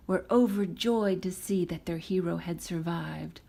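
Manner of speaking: ordinary delivery, nothing marked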